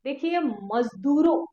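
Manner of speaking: ordinary talk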